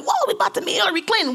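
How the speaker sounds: high, fast, giddy squeal; excited. Retold or imitated. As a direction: gush it in a rush. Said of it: "We all excited".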